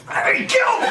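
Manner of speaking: sudden high cry of pain; real shock yelp. Real, not acted. Comic shocked while starting a story, yelping high. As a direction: blurt it as a jolt hits.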